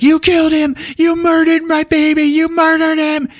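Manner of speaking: very high hysterical scream; acted shrieking accusation; retold screaming. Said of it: "she's screaming at him".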